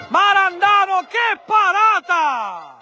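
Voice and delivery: high shouted save call, slowed; excited shout. Real, not acted. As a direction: burst out at a great save. Great save, commentator shouts. (urlo per la parata)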